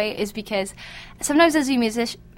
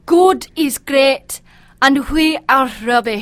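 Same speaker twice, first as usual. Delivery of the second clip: slow, louder Scottish brogue; 6 dB louder, slower than usual. Retold or imitated. Guest tries the comedian's Scottish line in a slow, louder brogue. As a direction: lean hard into a mock Scottish brogue. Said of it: "Wait, can I try?".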